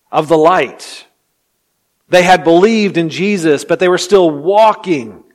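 loud, emphatic pulpit delivery; impassioned preaching. Real, not acted. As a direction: press each phrase home with force. Preaches at full force.

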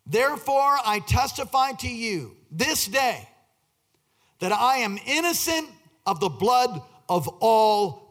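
slow, emphatic verse reading; declaimed scripture. Acted. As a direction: proclaim the verse word by word. Reads scripture aloud in preaching style.

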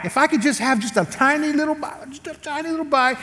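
high, small wheedling voice; tiny pleading voice. Retold or imitated. Husband begs wife for a tiny bite, high and wheedling.

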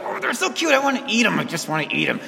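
high doting coo, fading off; mock baby-doting coo; cooing at a cute kid. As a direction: gush over a baby like you could eat it. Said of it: "You're like".

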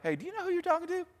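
mock a snooty rebuke; mock arrogant. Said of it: "That sounds kind of arrogant".

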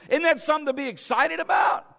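loud high preaching shout; full-force preacher's cry; excited shout. Acted. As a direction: shout it to the rafters with joy.

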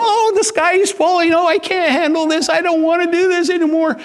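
high, fast, frantic squeal; panicky Chicken Little. Retold or imitated. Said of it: "going Chicken Little and going".

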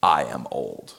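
low, flat, blunt statement; blunt and direct. Shown as a demonstration. Zechariah bluntly telling the angel he is old. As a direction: say I am old dead straight. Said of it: "You don't talk like that".

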